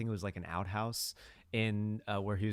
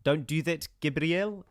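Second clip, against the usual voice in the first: attempted South African accent; 6 semitones higher than usual. Shown as a demonstration. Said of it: "that is my trying to do a South African accent".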